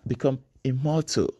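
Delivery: normal talking voice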